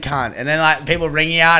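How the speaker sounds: usual speaking voice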